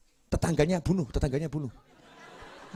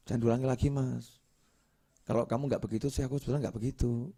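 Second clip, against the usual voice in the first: much softer, giving in gently; softened voice; 8 dB quieter, faster than usual. Retold or imitated. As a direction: let the voice drop as the husband backs down. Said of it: "Mulai suaranya turun". (suara suami melunak dan jauh lebih pelan)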